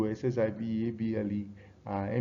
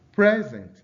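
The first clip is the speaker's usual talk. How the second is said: PRESent, high loud first syllable, falling away; stress on first syllable; 9 semitones higher, 8 dB louder than usual. Shown as a demonstration. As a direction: hit the PRES in present hard. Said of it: "stress on the first syllable".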